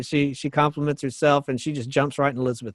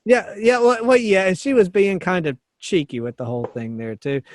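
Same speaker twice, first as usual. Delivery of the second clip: ordinary talk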